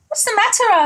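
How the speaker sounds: high, gentle question; George asking kindly. Acted. Giant asking a howling dog, high and caring. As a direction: ask it up high with concern. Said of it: "asked George".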